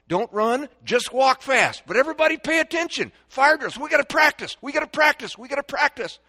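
high, loud, insistent instructions; drill leader. Acted. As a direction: drum it into a class during a fire drill. Acting out fire drill instructions.

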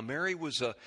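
ordinary talk

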